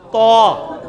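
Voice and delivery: تو held as a heavy rounded "taw"; thick Taa. Shown as a demonstration. Teacher answers his own quiz, thick Taa. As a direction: thicken and stretch the t sound of تو. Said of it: "تا کو موٹا کیا".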